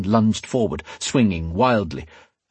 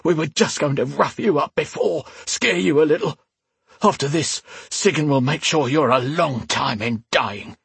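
high, strained henchman voice; hurt and vengeful; injured thug, bitter; 6 semitones higher than usual. Acted. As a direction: threaten your attacker through the pain.

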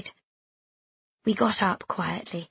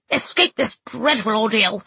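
sniffy. A narrator's reading. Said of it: "Missus B sniffed".

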